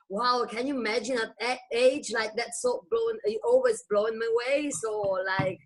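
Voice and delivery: ordinary talk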